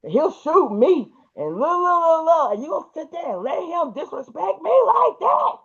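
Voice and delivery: high, whiny imitating his tirade; a shrill mock rant of a jealous husband. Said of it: "he just starts going crazy".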